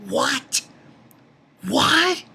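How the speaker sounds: high rising double question; imitating his own confusion; disbelief. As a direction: ask it high and baffled.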